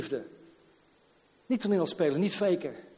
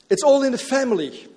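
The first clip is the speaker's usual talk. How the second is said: higher and louder, falling on all; proclaimed; 7 semitones higher, 8 dB louder than usual. Acted. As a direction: call it out to the congregation. Preacher proclaims the motto.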